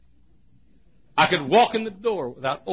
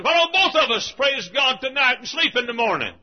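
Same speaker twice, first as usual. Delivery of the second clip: cheeky retort; 13 semitones higher, 8 dB louder than usual. Retold or imitated. Preacher's cheeky retort retold.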